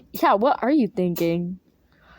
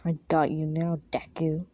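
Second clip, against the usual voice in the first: much lower and softer, menacing; 6 semitones lower, 5 dB quieter than usual. Acted. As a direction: purr it low like a captor. Bakugo low and menacing.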